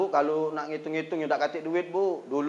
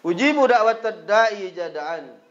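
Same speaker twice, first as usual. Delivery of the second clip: slow recitation; 3 semitones higher, 8 dB louder, slower than usual. Acted. Verse line recited slowly.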